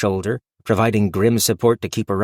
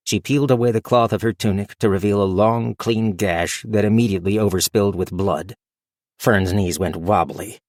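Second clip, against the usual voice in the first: ordinary delivery, nothing marked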